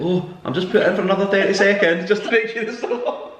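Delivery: high-pitched playful excuse, imitating; mock innocent excuse. Retold excuse when caught getting up.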